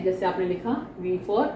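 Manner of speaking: ordinary talk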